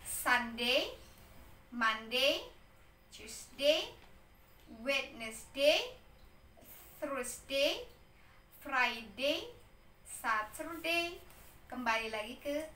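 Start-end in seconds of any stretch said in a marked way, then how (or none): 0.1-11.2 "Sunday, Monday, Tuesday" read out one by one, each ending on a rise; "Sunday" to "Saturday" as a careful read-aloud list, pausing after each; model pronunciation. Shown as a demonstration. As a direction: model each day from "Sunday" to "Saturday" slowly and separately for pupils to repeat. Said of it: "cara baca yang benarnya".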